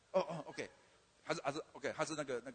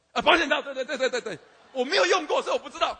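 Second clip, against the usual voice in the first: loud laughing blurt; 4 semitones higher, 10 dB louder than usual. Real, not acted. Blurts the answer, laughing loudly.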